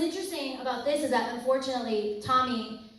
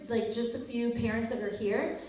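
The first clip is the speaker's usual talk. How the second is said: usual speaking voice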